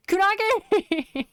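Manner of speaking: high, loud playful cry; excited call. Pretend. Streamer calling out mid-game, high excited squeal. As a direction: burst out with it, breaking into laughter. Calls out a catchword, laughing.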